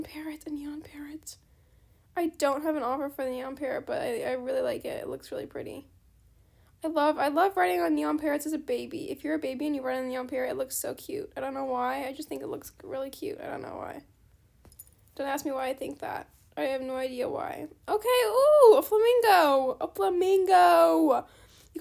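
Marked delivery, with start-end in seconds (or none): 0.0-1.4 awed whisper. Real, not acted. Spotting a rare pet. 17.9-21.3 louder, higher, slow and drawn-out delight; excited ooh. Real, not acted. Excited at seeing a flamingo.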